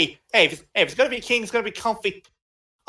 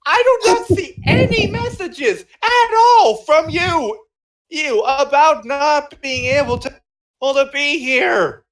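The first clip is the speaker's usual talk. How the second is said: indignant protest; 6 semitones higher, 4 dB louder than usual. Real, not acted.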